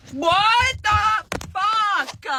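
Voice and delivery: stretched-out shocked exclamation; stunned outrage. Real, not acted. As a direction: draw it out in shocked disbelief. Friend hangs up on her.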